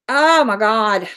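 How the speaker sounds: slow mock "oh my God"; mocking dramatic. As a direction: parody the troll's fuss, slowly. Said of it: "Quit acting like".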